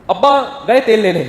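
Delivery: slow, loud scornful brush-off; dismissive mother. Retold or imitated. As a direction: shrug the father off with contempt.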